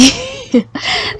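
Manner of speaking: high breathy giggle. Real, not acted. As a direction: laugh it off shyly. Laughing off accent talk.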